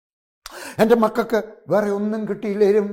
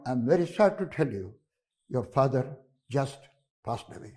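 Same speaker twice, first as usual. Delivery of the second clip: gentle bad news; 6 semitones lower, 5 dB quieter, much slower than usual. Doctor breaks news to son.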